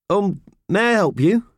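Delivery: high, polite voice of a boiler. A narrator's reading. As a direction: offer help courteously and a bit hesitantly. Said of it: "asked the boiler politely".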